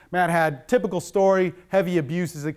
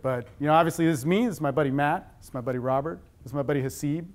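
ordinary delivery

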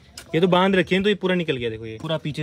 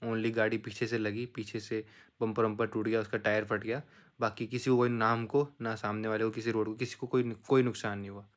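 softer, calm telling of what happened; lower, softer vlog narration; reflective voiceover; 5 semitones lower, 9 dB quieter than usual. A narrator's reading. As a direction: look back quietly for the viewers. Reflective voiceover after the day.

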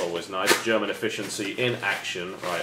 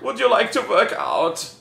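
mock German accent; 12 semitones higher, 5 dB louder than usual. Mock translation of the German note.

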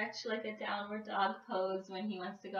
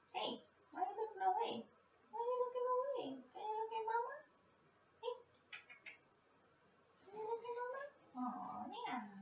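much higher, soft coaxing pet voice; dog talk; 13 semitones higher, 5 dB quieter, faster than usual. Real, not acted. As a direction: sweetly coax the dog to look back. Coaxing dog to look at camera.

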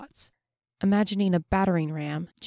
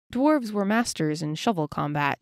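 ordinary delivery, nothing marked

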